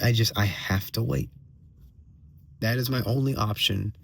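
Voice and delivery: ordinary delivery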